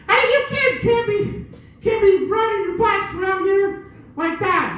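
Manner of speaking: put-on whiny complaining Karen scolding the kids. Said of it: "one of these Karens went out there and be like".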